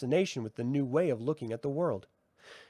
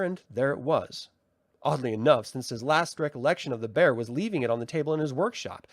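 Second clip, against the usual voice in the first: ordinary delivery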